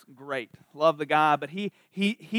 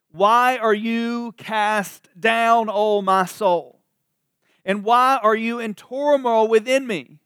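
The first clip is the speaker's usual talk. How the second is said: psalm read aloud; 3 semitones higher, 6 dB louder, slower than usual. Acted. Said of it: "the psalmist saying these things to himself".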